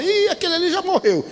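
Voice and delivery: imitated onlooker's high-pitched exclamation; espanto de curiosos. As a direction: voice jumps high, mimicking a shocked bystander. Imita curiosos vendo o acidente. (espanto agudo de curioso, imitado)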